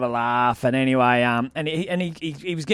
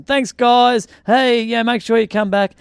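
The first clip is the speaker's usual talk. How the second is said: raised voice, pitched up; acted hearty greeting; 5 semitones higher, 4 dB louder, slower than usual. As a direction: call cheerily to the departing crowd. Re-enacts greeting departing audience.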